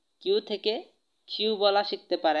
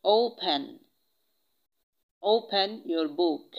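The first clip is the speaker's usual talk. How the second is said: normal talking voice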